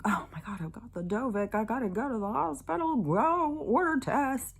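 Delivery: rising, hammed-up worried voice; mock panic. Vlogger imitating people who think they must get to hospital, mock panic. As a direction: act out a fretful patient, pitch climbing at the end.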